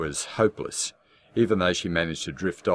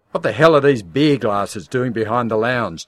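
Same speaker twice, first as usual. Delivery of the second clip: loud furious shout, pitched up; angry yell; 6 semitones higher, 10 dB louder than usual. Acted. Husband yelling at wife about beer glasses, loud and angry. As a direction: bellow it at her. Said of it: "Jim yelled".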